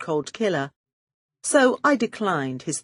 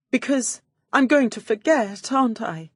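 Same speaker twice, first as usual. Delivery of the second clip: mock tearful; 6 semitones higher than usual. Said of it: "I paused to sniff".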